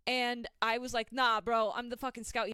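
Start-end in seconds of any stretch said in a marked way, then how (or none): 1.1-2.5 loud, brash retelling of a game voice chat shout; Scout voice. Retold or imitated. To the guy in voice chat, mock Scout voice. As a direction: bark it back as the cocky Scout. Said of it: "in the scout's voice".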